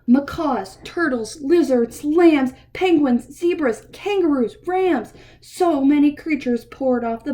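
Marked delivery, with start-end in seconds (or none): none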